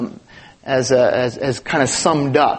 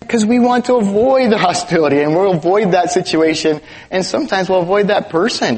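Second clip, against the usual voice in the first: normal talking voice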